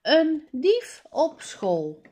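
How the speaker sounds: title called out brightly; story title read aloud with emphasis. A narrator's reading. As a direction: proclaim the title to the class. Title read again, announced.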